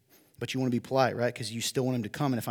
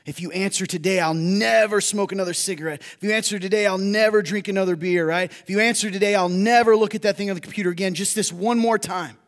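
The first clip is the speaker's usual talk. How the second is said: loud, pleading, high bargaining vows; preaching at full force; 7 semitones higher, 8 dB louder than usual. Acted. Preacher voicing a sinner's bargain, loud and raised. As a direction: pour out each empty promise, raised and loud.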